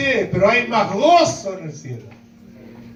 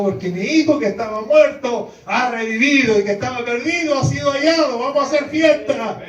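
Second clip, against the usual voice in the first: joyful father; 6 semitones higher than usual. Retold or imitated.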